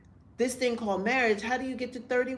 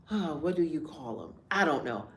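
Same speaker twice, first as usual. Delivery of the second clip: normal talking voice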